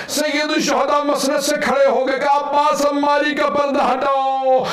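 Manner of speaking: the preacher's wailing high shout at the peak of the lament; preaching at full force. Acted. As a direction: cry out the climax to the mourners, drawing words out. Majlis climax, shouted lament.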